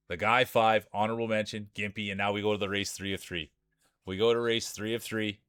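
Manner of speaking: ordinary talk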